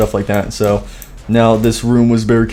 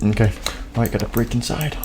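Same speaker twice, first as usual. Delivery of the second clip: sneaky; 8 dB quieter, faster than usual. Acted. Sneaking into the pizzeria.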